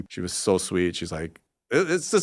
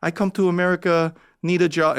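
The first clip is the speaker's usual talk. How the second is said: pitched well up, broken English caricature; mock broken-English voice; 8 semitones higher, 4 dB louder than usual. Comedian reading his made-up story in a high mock broken-English voice. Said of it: "this is the voice I hear in my head".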